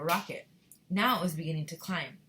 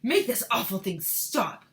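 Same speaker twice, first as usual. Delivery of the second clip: Mister Teavee; 2 semitones higher, 7 dB louder, slower than usual. Acted. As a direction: order it slowly as a man. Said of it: "better read it in a male voice".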